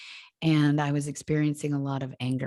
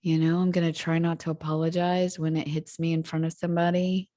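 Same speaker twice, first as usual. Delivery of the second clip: ordinary talk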